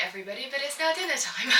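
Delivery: ordinary talk, nothing marked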